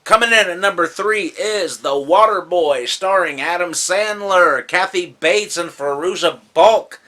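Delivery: loud, high announcer build-up; countdown announcer. Real, not acted. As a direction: announce the entry and cast with fanfare. Countdown announcement of next entry.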